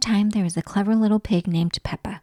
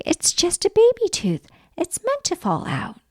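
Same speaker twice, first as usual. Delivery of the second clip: higher, light motherly voice; Mummy Pig; 9 semitones higher than usual. Acted. Mummy Pig explaining a baby tooth, lightly higher. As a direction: speak up high and reassuring as Mummy Pig.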